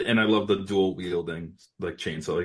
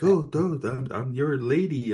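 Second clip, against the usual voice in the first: higher, playfully put-on come-on; mock seductive; 6 semitones higher, slower than usual. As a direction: imitate a woman lightly buttering someone up. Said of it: "kinda seduces him".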